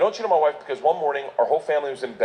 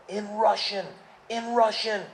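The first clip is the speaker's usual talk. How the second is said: usual speaking voice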